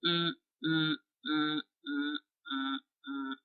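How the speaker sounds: humming mm through the nose with lips shut; closed-mouth hum. Shown as a demonstration. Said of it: "which goes like this".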